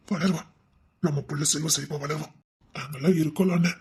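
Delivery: reassuring his grieving brother; soft reassurance. Acted. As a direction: soothe him gently. Comforting crying brother.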